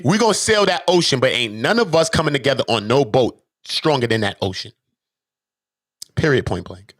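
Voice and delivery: normal talking voice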